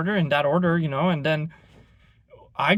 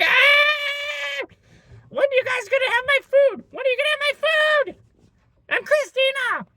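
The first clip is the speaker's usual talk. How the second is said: much higher, whiny put-on Karen; mock Karen voice; 3 dB louder than usual. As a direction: lampoon the helpless customer in a high voice. Karen demanding her food.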